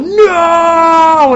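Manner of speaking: high, loud wail of dismay; anguished cry. Retold or imitated. Reacting to his screen, a loud anguished cry. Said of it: "I looked at my fucking screen and I was like".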